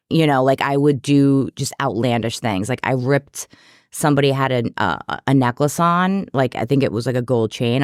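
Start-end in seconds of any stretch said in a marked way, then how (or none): none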